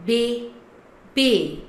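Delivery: BÉBÉ as "bay ... bay", two separate syllables; French é sound. Shown as a demonstration. Teacher demonstrating the é sound, French pronunciation. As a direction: split bébé with a pause, falling. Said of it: "So then sound is A".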